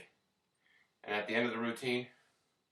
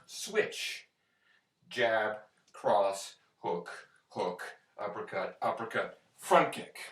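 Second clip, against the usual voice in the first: boxing coach calling combos; slower than usual. Shown as a demonstration. Calls combo while doing it.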